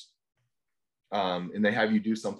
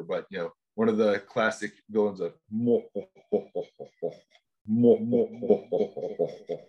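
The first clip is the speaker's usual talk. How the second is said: villain laugh. Retold or imitated. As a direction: cackle like a classic movie villain. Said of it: "I got to do the evil laugh".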